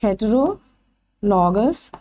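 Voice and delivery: Heterologous drawn out very slowly with a rising end; slow dictation. Shown as a demonstration. Teacher dictates the new term slowly to the class. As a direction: stretch heterologous out so students can write it. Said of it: "तो इन्हें क्या बोलेंगे?".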